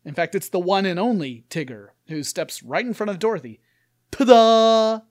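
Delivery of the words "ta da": big showy shout, much louder; Tigger's shouted ta-da; yelled ta-da. Acted. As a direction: burst in like a stage reveal. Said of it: "he yells".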